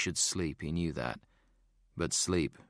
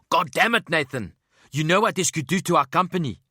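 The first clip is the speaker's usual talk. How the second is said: furious, high South African yell; angry shout; 10 semitones higher, 9 dB louder than usual. A narrator's reading. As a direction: explode at your partner. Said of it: "made the fat man more angry".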